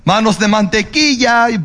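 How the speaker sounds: Spanish pronunciation; Spanish phrase. Shown as a demonstration. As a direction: say manos de mantequilla the Spanish way. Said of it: "That's what I'll call them".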